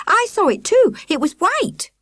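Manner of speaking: put-on high, loud insistent boy; boy calls after the leader about the ghost. A narrator's reading. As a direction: insist urgently it was real.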